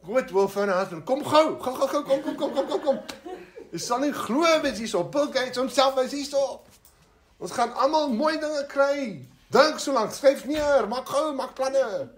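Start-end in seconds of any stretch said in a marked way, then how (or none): 1.0-12.1 high, hurried, giddy calls of kom gou; excited calling out. Pretend. Preacher imagining calling her husband, excited high calling. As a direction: wave the whole family over in a rush. Calling family to come quickly.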